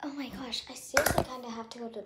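soft breathy whisper. Real, not acted. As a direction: whisper it under your breath. Quiet reaction in the car.

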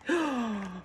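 a quiet, amazed intake of breath; soft gasp. Real, not acted. Unboxing a bag, she gasps softly at the inside. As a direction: gasp softly at the pretty lining.

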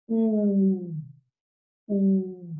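"U" as a long held vowel, twice; letter sound. Shown as a demonstration. Teacher bringing children the letter U, long held vowel. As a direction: hold each "U" steady, with a pause between. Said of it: "A traernos la U".

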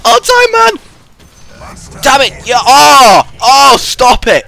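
very high, loud yells; excited shouting. Real, not acted. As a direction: scream at the game. Excited yelling during fight.

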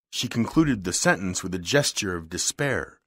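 ordinary delivery

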